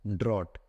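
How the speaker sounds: "ड्रॉट" as drawt, pitched low after a pause; pronunciation demo. Shown as a demonstration. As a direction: answer with "ड्रॉट", one open "aw" vowel. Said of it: "इसको क्या पढ़ते हैं?". ("ड्रॉट" का उच्चारण, खुले "ऑ" के साथ)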